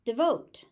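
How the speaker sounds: "Devote" with very short "de", stressed VOTE; short first syllable. Shown as a demonstration. Teacher showing second-syllable stress, short first syllable. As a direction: barely say "de" in "Devote", hit VOTE. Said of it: "first syllable is very short".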